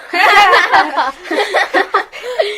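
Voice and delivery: bright burst of happy laughter; delighted laugh. Acted. Laughs after the reveal.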